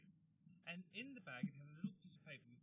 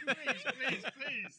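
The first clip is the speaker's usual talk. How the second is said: pleading; 11 semitones higher, 13 dB louder, much slower than usual. Real, not acted. Begging the hosts to stop.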